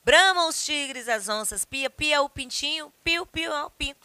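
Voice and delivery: lively poem read with animal sounds. Acted. Reads poem aloud. (leitura de poema animada)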